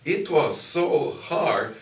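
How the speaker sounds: slow loud stress on so hard; emphatic. Real, not acted. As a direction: lean hard on how tough it was. Recalling hard first days.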